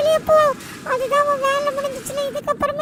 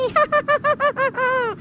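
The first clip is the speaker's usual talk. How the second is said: evil laugh. Acted. Villain gloats over destruction.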